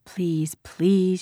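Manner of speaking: mild inward plea; imploring. A narrator's reading. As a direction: beg silently, with restraint. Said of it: "she implored in her mind".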